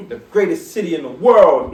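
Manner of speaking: loud proud hype call; hype shout. Real, not acted. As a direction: bellow it like a hypeman.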